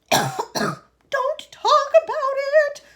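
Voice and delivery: high, loud, slow wail. A narrator's reading. Mother wailing at her husband. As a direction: wail it in distress. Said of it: "wailed Missus T V".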